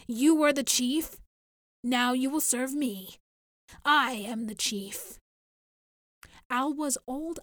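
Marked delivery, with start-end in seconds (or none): 0.1-3.2 loud, scornful challenge; domineering. Acted. Dictator overrules the chief. 3.8-5.2 slow, hard and louder, I am the chief; domineering claim. Acted. Dictator seizes chieftainship.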